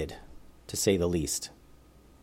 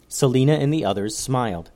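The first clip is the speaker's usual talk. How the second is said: usual speaking voice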